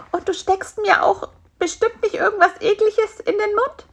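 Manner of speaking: very high, suspicious fly voice; wary fly. Acted. Fly doubts what spider will feed it, wary. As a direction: ask as the mistrustful little fly.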